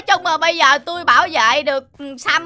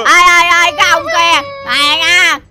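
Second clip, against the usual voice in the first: loud protective calling-out; raised-voice challenge; indignant shout; 5 dB louder, slower than usual. Acted. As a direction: shout the bully off your friend. Confronting man threatening his friend.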